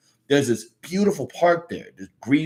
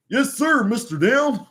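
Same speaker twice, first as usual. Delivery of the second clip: much higher, dopey sidekick; eager sidekick voice; 10 semitones higher, slower than usual. Acted. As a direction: chirp it like a goofy eager helper. Sidekick answers the bartender.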